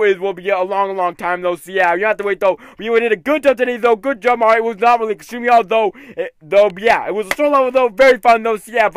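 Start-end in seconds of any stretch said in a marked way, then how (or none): none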